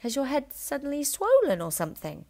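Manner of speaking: sly innocent question; Matilda's voice. A narrator's reading. Matilda teasing her father, mock innocent. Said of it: "she said".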